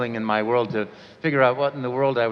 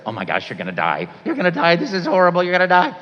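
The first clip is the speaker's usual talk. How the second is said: inner panic; 3 semitones higher, 5 dB louder than usual. Retold or imitated. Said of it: "my subtext was".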